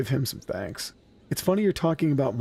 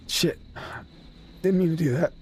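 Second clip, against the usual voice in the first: choked-up whisper; 5 semitones higher than usual. Real, not acted. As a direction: breathe it out, fighting tears. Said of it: "I'm gonna get emotional".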